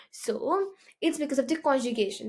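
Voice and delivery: normal talking voice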